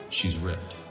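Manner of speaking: normal talking voice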